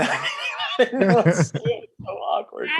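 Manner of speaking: laughing through the words; embarrassed laugh while telling it. Real, not acted. Laughing at his own awkwardness.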